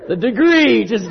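talking through laughter; trying to speak while still cracking up; laughing. Real, not acted. Preacher explaining the joke through his laughter.

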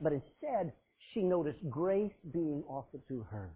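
usual speaking voice

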